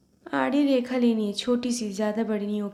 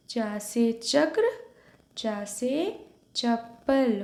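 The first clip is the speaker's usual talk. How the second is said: slow, each word drawn out for repetition; slow model reading; much slower than usual. Shown as a demonstration. Hindi teacher modelling the sound, slow drill. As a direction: model it slowly for the class. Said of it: "इसका pronunciation कैसा है?".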